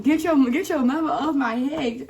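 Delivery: a loud, high, hurting plea; pained yell. Retold or imitated. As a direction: retell yelling while your braids get yanked. Hair being pulled.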